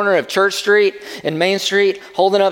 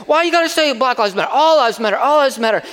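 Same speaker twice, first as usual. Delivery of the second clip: higher, louder imitation of a belligerent drunk guy; drunk heckler voice; 9 semitones higher, 3 dB louder than usual. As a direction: mimic the heckler getting in their face. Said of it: "But he's like".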